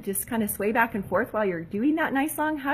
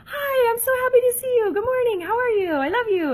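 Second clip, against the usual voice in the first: much higher and louder, gushing with delight; joyful greeting; 6 dB louder than usual. Pretend. Mom rewriting her morning hello to her daughter, gushing. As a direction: pour happiness into every word. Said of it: "I would say".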